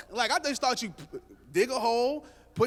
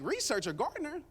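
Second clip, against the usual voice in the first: pitched-up scoff at the idea; mock offended, as if the idea is absurd; incredulous echo; 5 semitones higher, 5 dB quieter than usual. Husband scoffing at his own plan to hire a gardener, incredulous.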